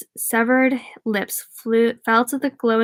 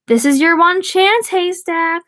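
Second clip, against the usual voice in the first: high, loud taunting call; a menacing call from the stairs; 7 semitones higher, 4 dB louder than usual. A narrator's reading. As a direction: call down to him from above. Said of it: "the voice called from behind and above him".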